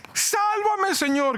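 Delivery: high, urgent cry for help; desperate shout. Acted. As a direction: scream for rescue while sinking. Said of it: "gritó".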